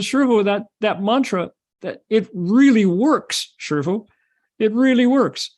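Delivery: high-pitched amazed report; excited disciple. Acted. Disciple reports to master that the mantra worked, excited. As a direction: gush with the news, stretching key words.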